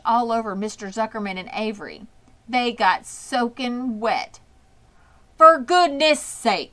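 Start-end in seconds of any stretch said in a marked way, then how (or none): 5.4-6.7 loud, higher, slow bellow; drenched farmer bellowing. A narrator's reading. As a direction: bellow it, soaked and exasperated. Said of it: "bellowed Mister Zuckerman".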